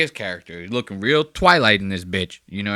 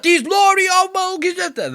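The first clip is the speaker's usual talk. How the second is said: very high, loud garbled British caricature; exaggerated British accent; 5 dB louder than usual. Streamer mimics player's weirdo accent, shrieky British caricature. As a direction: squawk like a cartoon Brit. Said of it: "like that Dan's doing".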